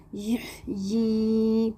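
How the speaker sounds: یہ stretched very slowly, clear ha sound; slow tajweed demo. Shown as a demonstration. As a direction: draw out یہ slowly as a recitation model. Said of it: "یہاں پر ہا ضرور اچھے سے نکالنا ہے". (تجوید کے ساتھ آہستہ ادائیگی)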